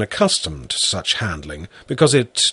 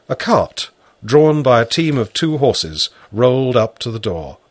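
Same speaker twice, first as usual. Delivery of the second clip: plain reading voice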